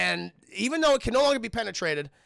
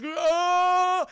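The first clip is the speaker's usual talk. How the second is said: high loud hammed-up yell; mock scream; 10 semitones higher, 8 dB louder than usual. Logan flipping in the exploding Humvee, mock yell. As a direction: yell like a falling action hero.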